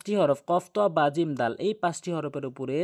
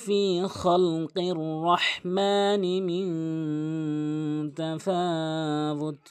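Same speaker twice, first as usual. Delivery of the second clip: Quran recitation; 3 semitones higher, much slower than usual. Shown as a demonstration. Teacher recites the verse for learners, measured Quran recitation. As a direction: recite the verse with long held vowels.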